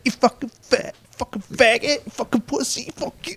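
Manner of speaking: high-pitched mock insults fading away; squeaky string of insults trailing off. Pinned drunk squeaking insults at the bouncer, high and fading. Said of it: "And he's like".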